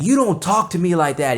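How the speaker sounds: indignant retort. Retold or imitated. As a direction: act out an offended coworker snapping back. Acts out angry coworker's retort.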